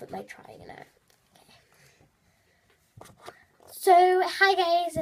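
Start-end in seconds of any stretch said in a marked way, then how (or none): 3.7-5.0 high, loud greeting; put-on perky vlog opening; attempted YouTuber intro. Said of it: "Okay, I can't do an intro".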